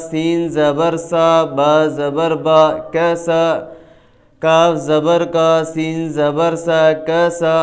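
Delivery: measured recitation spelling each letter as in "دال زبر دا", then blending as in "درا"; hijje drill. Shown as a demonstration. Teacher drilling students in broken-up reading, measured hijje from "دال زبر دا" to "سجدا". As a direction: chain the pieces evenly, "سین زبر سا", then the joined word. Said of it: "یہ تو ہو گئے ہجے".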